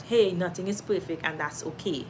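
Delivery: ordinary delivery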